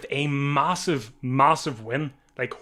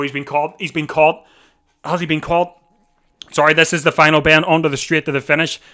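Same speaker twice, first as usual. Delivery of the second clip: ordinary talk, nothing marked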